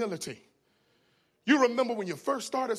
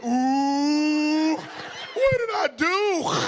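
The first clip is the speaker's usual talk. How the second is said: high, drawn-out "ooh" and wail; hammed-up high wail, stretched out slowly; imitated scandalized reply; 5 semitones higher, 4 dB louder, much slower than usual. Relative reacts to child using H word, mock scandalized.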